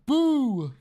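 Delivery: sudden loud boo. Acted. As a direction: shout the boo. Voiced boo.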